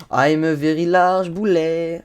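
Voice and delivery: put-on slow, drawn-out English with a thick French accent; deliberately clumsy French-accented English; bad English accent. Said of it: "avec mon accent anglais de merde".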